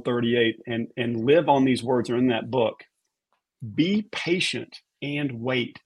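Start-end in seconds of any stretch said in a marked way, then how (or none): 3.7-5.8 each word read slowly and separately; slow reverent reading. Retold or imitated. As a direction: weigh every word of the verse. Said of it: "I would read each word".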